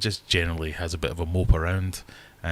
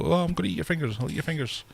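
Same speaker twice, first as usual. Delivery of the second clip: higher silly greedy fish voice; high goofy fish voice, hungry; voicing the fish; 10 semitones higher, faster than usual. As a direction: play the fish, eager for fingers. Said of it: "He is starting to go".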